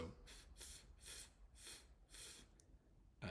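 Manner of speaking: "f" as bare voiceless air, repeated with gaps; very soft "f", no vowel attached; isolated F sound. Shown as a demonstration. Teacher showing that G H can sound like F, isolated F. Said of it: "can sometimes sound like".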